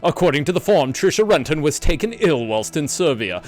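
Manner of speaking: dramatic reading. Acted. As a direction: read the note like a narrator. Reads game text aloud.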